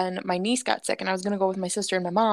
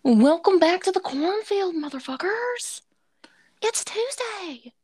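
high, husky whispery greeting; playful stage-whispered show opener; put-on silly voice; 8 semitones higher than usual. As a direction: welcome them in a whispery, pitched-up character voice. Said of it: "I'm gonna stop talking like that".

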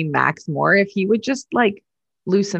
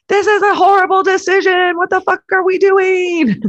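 much higher, louder frantic yell; pretend yelling down the hallway; 13 semitones higher, 4 dB louder than usual. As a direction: storm down the hall yelling at the bosses. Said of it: "run in through the hallways, be like".

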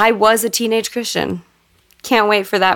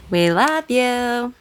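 gushing; 3 semitones higher, 4 dB quieter, much slower than usual. Real, not acted. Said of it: "gushing shout-out to Matt".

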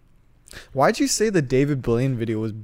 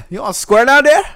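loud, high mocking bro voice; cackling bro taunt; mock tough guy; 10 semitones higher, 10 dB louder than usual.

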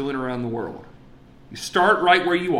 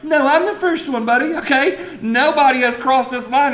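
high, loud mock-indignant protest; indignant insistence; 10 semitones higher, 5 dB louder than usual. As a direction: insist you got there first, half laughing. Insisting he is the first.

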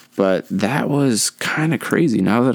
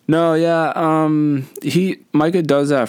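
high-pitched imitation of a helpful pal; friend interjecting; 8 semitones higher, slower than usual. Classmate interjects to back up his friend's fib, high-pitched interjection. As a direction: jump in high to cover for your buddy. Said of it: "just interjects and says".

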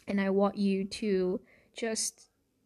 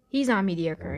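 ordinary talk, nothing marked

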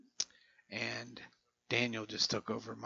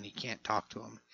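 ordinary talk

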